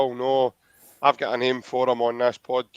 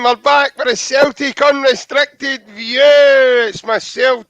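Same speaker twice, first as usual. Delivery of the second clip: loud, high announcer opening; show intro; 10 semitones higher, 10 dB louder, slower than usual. Acted. Host welcoming viewers, booming announcer opening. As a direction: open the show like a big broadcast.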